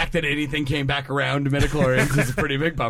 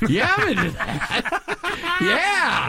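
pitched way up, slow and laughing; higher voice; 11 semitones higher, much slower than usual. Shown as a demonstration. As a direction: push the voice up high. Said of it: "I gotta say it a little bit higher".

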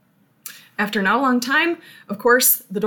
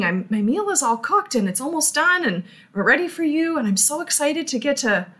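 eager on the phone; 5 semitones higher than usual. Retold or imitated. Homeowner on phone with Jesus.

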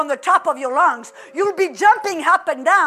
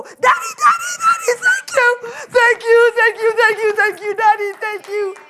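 shrieking thanks, far higher and louder; overjoyed child; 6 semitones higher, 5 dB louder than usual. Acted. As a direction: burst out like a kid who just got his wish. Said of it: "and he's so excited".

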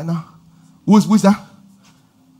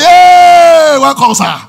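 loud, high cheerful greeting; excited welcome; 12 semitones higher, 11 dB louder, much slower than usual. Retold or imitated. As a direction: hail the rich uncle happily. Kids greeting the generous uncle.

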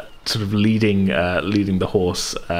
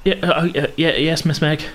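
put-on high, flustered boy voice; stammer, overwhelmed and awed; timid young Nairn; 8 semitones higher than usual. Young Nairn greeting the miss, flustered.

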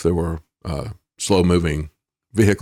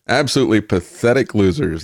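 ordinary talk

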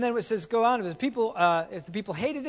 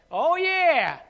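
mock delighted spender; 8 semitones higher, 7 dB louder than usual. Mocking spender.

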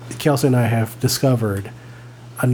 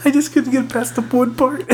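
ordinary delivery

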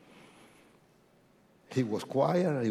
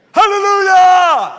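much higher, loud hallelujah cry; joyful shout; 16 dB louder than usual. Real, not acted. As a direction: shout it out to the church. Shouted praise in church.

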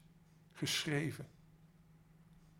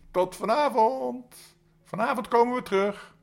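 taunting ghosts; 12 semitones higher, 12 dB louder than usual. Acted. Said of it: "lachend en fluitend verdwenen".

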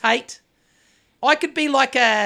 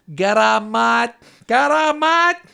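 bad Greek accent; 2 semitones higher, 7 dB louder, much slower than usual. Shown as a demonstration. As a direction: belt out a terrible Greek accent, rising on the repeat. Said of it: "that is a terrible Greek accent".

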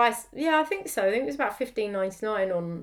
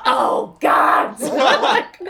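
loud frustrated cry of despair; exasperated shout; 5 semitones higher, 11 dB louder, much slower than usual. Retold or imitated. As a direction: yell it out in frustration. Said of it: "I was like".

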